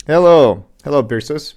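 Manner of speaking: ordinary talk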